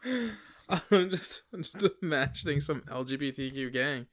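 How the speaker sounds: talking through a laugh; giggling while talking. Real, not acted.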